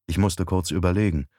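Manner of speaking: plain reading voice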